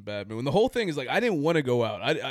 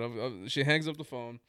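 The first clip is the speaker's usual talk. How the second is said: ordinary talk, nothing marked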